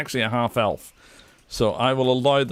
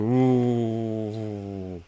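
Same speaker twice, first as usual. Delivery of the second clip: scared orcs; 4 semitones lower, 4 dB quieter than usual. Acted. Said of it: "And all the warriors go".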